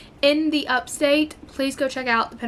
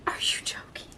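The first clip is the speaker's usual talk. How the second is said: soft unvoiced whisper; awed whisper; 7 dB quieter than usual. Real, not acted. Book lover whispering in awe at a rare find. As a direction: breathe it in disbelief. Awed at finding original covers.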